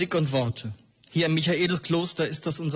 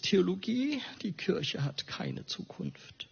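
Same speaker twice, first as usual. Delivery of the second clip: a hint of a despairing father; somewhat despairing; 4 semitones higher, 6 dB quieter than usual. Father warning his son off theology, mildly despairing. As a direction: quote Dad with a touch of resignation, letting it sink away. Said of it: "sagte oft etwas verzweifelt".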